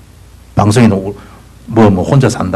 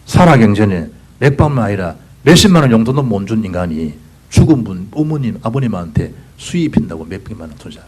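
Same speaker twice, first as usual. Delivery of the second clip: ordinary talk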